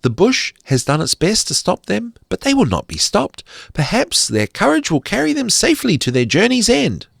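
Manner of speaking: high, quick fairy voice. A narrator's reading. Fairy speaking hopefully to its friends, high and quick. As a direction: chatter it brightly and fast like a tiny fairy.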